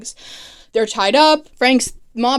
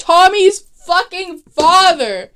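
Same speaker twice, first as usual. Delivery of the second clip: normal talking voice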